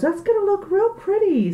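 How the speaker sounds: high, sweet imitation of the daughter; daughter's voice. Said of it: "she goes".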